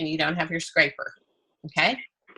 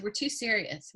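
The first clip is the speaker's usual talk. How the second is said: ordinary talk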